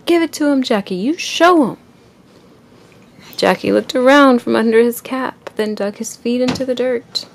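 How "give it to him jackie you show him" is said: loud, high cheering from the stands; cheering shout. A narrator's reading. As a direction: cheer him on like a fan in the crowd. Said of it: "Luke shouted with the crowd".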